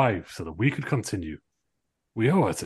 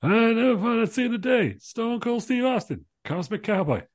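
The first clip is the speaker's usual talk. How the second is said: high, slow amazed commentator; excited commentator; 12 semitones higher than usual. Acted. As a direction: marvel at it like a ringside caller.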